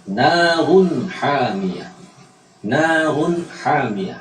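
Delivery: Narun hamiyah very slowly, long vowels, clear n before h; clear izhar. Shown as a demonstration. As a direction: chant Narun with a distinct n, then hamiyah. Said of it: "Maka diucapkan secara jelas".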